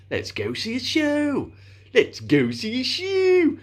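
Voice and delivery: high, drawn-out silly voice, building; increasingly silly. Retold or imitated. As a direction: make each repeat sillier and longer. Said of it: "more, slightly more silly".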